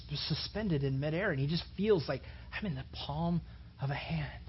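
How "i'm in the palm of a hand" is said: whisper. Retold or imitated. Man caught by God's hand.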